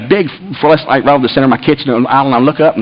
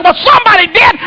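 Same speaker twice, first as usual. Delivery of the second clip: high, indignant comeback; angry retort. Retold or imitated. Husband arguing back over the thermostat, angry.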